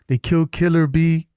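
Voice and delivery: a light take on the Half Baked line; movie line quote. Retold or imitated. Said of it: "what movie that came from".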